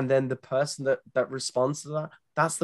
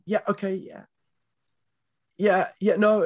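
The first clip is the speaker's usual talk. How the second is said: awkward reply; 6 semitones higher than usual. Retold or imitated. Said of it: "if you get on the chat and go".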